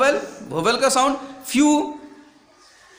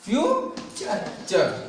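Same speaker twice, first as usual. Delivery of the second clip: Future stretched slowly, softer; slow softer demonstration of future; slow model word; 3 semitones lower, 5 dB quieter, much slower than usual. Shown as a demonstration. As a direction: draw future out for the class to copy. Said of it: "तुमको क्या बनना है?".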